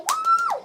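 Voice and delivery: loud, very high "woo!"; cheering whoop. Retold or imitated. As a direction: whoop it out in mock triumph. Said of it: "I'm cheering".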